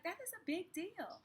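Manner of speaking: excited. Retold or imitated. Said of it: "and I was like".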